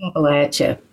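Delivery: "Appalachia" with a short a in the third syllable; local pronunciation. Real, not acted. Poet answers host's question, "Appalachia" with short-a LATCH. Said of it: "Do you say Appalachia or Appalachia?".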